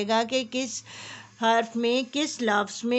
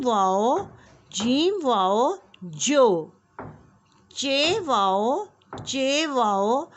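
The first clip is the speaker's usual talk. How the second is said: phonics drill; slower than usual. Shown as a demonstration. Teacher drilling KG class on joining letters with वाव. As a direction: sound out each letter pair for small children. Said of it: "अब देखें".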